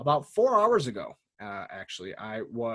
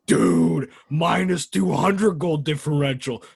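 put-on voice parodying the co-host's hype; slow, exaggerated mock enthusiasm; mocking co-host impression; 3 semitones higher, 4 dB louder, slower than usual. Host throwing co-host's talk back at him, mocking impression. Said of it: "because the way you talk, you're like".

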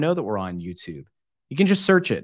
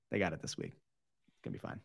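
muttering; 8 semitones lower, 15 dB quieter than usual. Real, not acted. Muttered aside.

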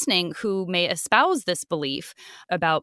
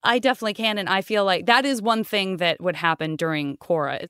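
usual speaking voice

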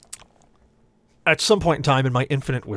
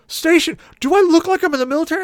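very high, loud, fast indignation; incredulous shriek; 8 dB louder, faster than usual. Retold or imitated. Long-haired passenger shrieks at cabbie, incredulous. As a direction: fire it off in outrage.

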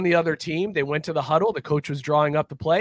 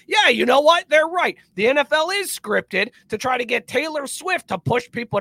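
higher, louder dumb-believer voice; mock gullible fan; 8 semitones higher, 3 dB louder than usual. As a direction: ham up a conspiracy nut who just got it. Mocking conspiracy believers.

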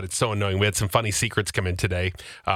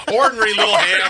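high, loud, amused jab; laughing tease; 9 semitones higher, 6 dB louder than usual. Real, not acted. Colleague teasing the struggling cohost, high laughing delivery.

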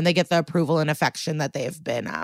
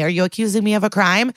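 usual speaking voice

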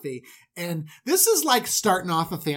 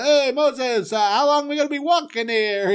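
whiny traveller; 8 semitones higher, 4 dB louder than usual. Acted. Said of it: "And, uh".

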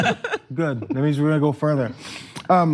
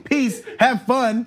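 high send-off voice; 9 semitones higher, much slower than usual. Acted. Mock send-off.

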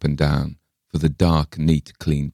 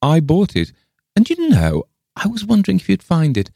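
high, loud, eager outburst; excited lady visitor; 14 semitones higher, 5 dB louder than usual. A narrator's reading. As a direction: burst out eagerly, pitch jumping up. Pym exclaims about the plate.

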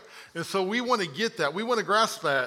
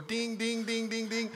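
high, quick string of dings; ping out playful little alert dings; imitated ding sound; 4 semitones higher, 7 dB quieter than usual. Joking about red arrows in an edited video, high dinging. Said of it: "see the little red arrows go".

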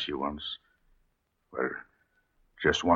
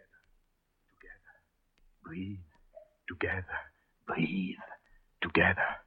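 softer and softer whispered coaxing; husband urging his wife to breathe, fading to a whisper; 10 semitones lower, 9 dB quieter than usual. Acted. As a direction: breathe with her, dying to a hush.

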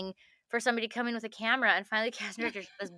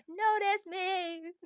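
pleading notice me; 8 semitones higher, 4 dB quieter, much slower than usual. Retold or imitated.

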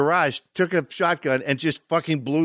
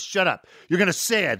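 mock-irritated order, higher in pitch; retold annoyance; 6 semitones higher than usual. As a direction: imitate a director overruling his actor. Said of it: "Then he got pissed".

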